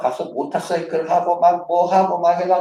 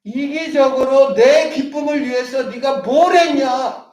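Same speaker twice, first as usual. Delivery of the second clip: selfish reproach; 8 semitones higher, 4 dB louder, slower than usual. Acted. Selfish accuser's voice.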